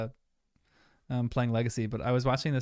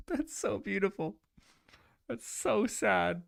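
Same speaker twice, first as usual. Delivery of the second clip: choked up; 7 semitones higher, 3 dB quieter, slower than usual. Real, not acted. Emotional reaction to sad scene.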